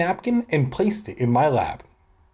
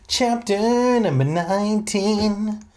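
slow, raised announcer read; chapter announcement; 8 semitones higher, slower than usual. Acted.

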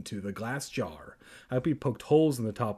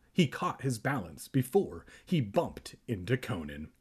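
usual speaking voice

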